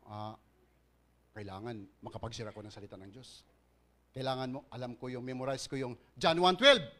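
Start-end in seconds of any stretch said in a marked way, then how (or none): 0.1-3.4 inner resolve. Retold or imitated. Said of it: "sabihin mo".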